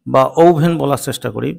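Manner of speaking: ordinary delivery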